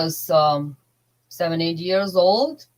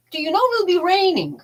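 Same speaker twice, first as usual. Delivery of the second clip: mock casual neighbor; 11 semitones higher, faster than usual. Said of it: "Just like".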